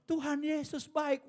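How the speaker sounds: high, slow parrot. Retold or imitated. Parrot speaking to the amazed visitor, high and slow. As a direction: say it like a pious parrot.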